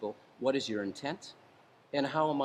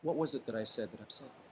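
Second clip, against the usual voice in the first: much softer, gentle and calming; 3 semitones lower, 8 dB quieter than usual. Shown as a demonstration. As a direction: lower your voice to settle him down. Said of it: "you might say".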